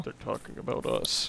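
hushed unvoiced conspiratorial line; whisper. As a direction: whisper it like a secret.

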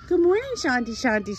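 squeaky high pet-talk greeting. Real, not acted. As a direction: coo it up high at the dog.

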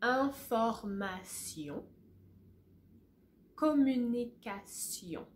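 Information, Communication with s and sion; French model. Shown as a demonstration. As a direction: say them with the class, French style. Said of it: "say that with me".

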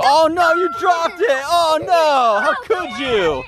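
mock dismay. Dad mocks dropped egg drama.

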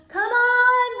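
sung high and loud; calling out. Acted. Bear calling Mole to fly the kite. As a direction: call your friend out to play.

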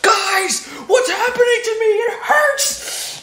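high hammed-up panicked shout; mock panic. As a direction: play up the panic.

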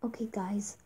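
soft hushed aside; whisper. Real, not acted. Player softly telling viewers before pausing, whisper. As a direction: lean in and whisper it.

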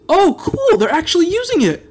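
genuinely thrilled discovery; delighted surprise. Real, not acted. Seeing players use his build, delighted surprise. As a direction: pitch leaps high, excited.

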